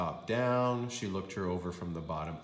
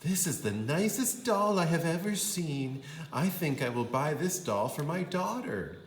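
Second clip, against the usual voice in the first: higher, admiring voice; lightly voiced shopper; charmed lady; 7 semitones higher than usual. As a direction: coo over the doll. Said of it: "She said".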